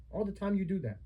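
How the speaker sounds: normal talking voice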